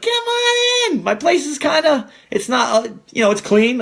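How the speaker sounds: sing-song "come on in"; welcoming. Retold or imitated. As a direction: draw out the invitation sweetly. Welcoming buyers into condo.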